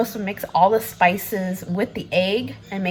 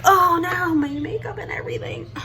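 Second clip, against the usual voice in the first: dismayed cry; 10 semitones higher than usual. Real, not acted. Tears ruining makeup.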